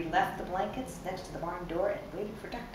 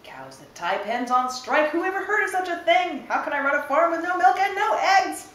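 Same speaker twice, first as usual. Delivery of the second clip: loud, much higher furious rant; 11 semitones higher, 10 dB louder than usual. Acted. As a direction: rant in outrage at the cows. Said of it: "Farmer Brown was furious".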